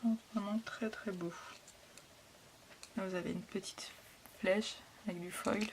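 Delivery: hushed, admiring whisper; French vlogger whispering in delight over a pretty sticker. Real, not acted. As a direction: breathe it out in awe over the foil. Admiring the foil up close.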